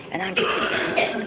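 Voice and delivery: normal talking voice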